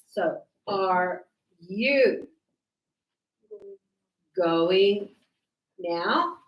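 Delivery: Are you going now drawn out slowly, with pauses between words; slow dictation. Shown as a demonstration. Teacher dictating the question slowly for students to write. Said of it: "it's the question".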